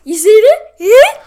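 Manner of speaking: high, loud squeaky mock voice; mock Ferb showing the picture, high and loud; mimicking Ferb. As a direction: pipe it up high and loud like a cartoon kid. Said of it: "He's like".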